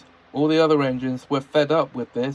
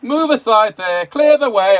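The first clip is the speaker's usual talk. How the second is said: high, loud bossy shouting; loud pompous yell; 10 semitones higher, 10 dB louder than usual. A narrator's reading. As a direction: yell it like a self-important boss. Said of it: "a loud, pompous voice yelling".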